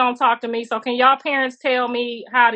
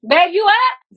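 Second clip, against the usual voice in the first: higher and louder call across the house; 9 semitones higher, 5 dB louder, slower than usual. Real, not acted. Calling out to her husband in another room, loud and high. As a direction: call out to check he's awake.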